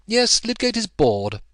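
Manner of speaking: a touch of mock comment; Will teasing. A narrator's reading. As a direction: tease gently.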